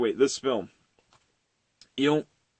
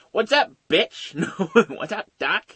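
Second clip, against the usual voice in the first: higher, cheeky cartoon wisecrack; cartoon rabbit; 8 semitones higher, 4 dB louder than usual. Shown as a demonstration. Rabbit greets the hunter, cheeky cartoon voice. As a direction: toss off the catchphrase cheekily. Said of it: "Looney Tunes characters".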